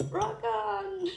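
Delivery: high, loud exclamation; mock rocker shout. Reader acting out rock on, mock shout. Performs the phrase, then repeats it.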